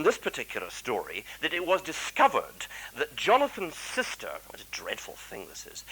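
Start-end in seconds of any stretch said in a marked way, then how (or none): 4.5-5.8 hushed aside. Real, not acted. Shocked aside about the illness.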